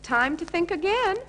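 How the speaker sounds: cheerful. Acted. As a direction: chirp it brightly. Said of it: "said Funshine Bear cheerfully".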